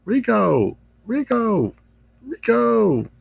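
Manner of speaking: high sing-song call of the name; calling Rico. Real, not acted. Host calling out to Rico on the stream, high teasing call.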